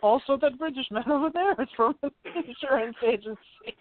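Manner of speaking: high comic character voice, cracking up; high-pitched mock delegate with laughter breaking in; put-on agent voice. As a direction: echo the silly high voice through a laugh. Co-host joins the insurance agents bit.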